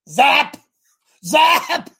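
high, punched, drawn-out zap; loud imitated zap. Owner triggering the dog's shock collar, loud mock zap. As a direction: shout it like firing a shock collar.

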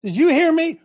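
high, loud demand; scolding grandparent. Acted. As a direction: pitch it up and demand an answer. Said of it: "ever said to your grandchild".

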